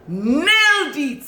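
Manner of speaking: high, drawn-out NAILED; excited, stretched exclamation; emphatic praise. Real, not acted. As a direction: belt out "Nailed it" slowly, high then falling.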